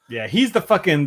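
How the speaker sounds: usual speaking voice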